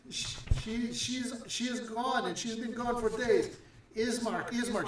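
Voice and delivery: high, pleading woman's voice; a frantic mother begging Ismark; mother desperate for her missing daughter, pleading high; distraught woman. Acted. DM voicing the wailing woman.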